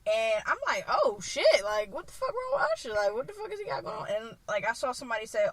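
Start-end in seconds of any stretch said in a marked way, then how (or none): 0.9-4.1 high-pitched, replayed disbelief; baffled reaction. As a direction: act out bewilderment, pitched up. Acted reaction to the fake money.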